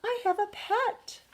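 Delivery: high, delighted goldfish voice; excited high-pitched cartoon fish; glubbing goldfish. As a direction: exclaim it high, as a thrilled little fish. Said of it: "Gilbert glubbed".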